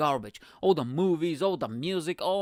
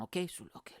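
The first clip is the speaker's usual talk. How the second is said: usual speaking voice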